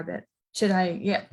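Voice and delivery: ordinary talk, nothing marked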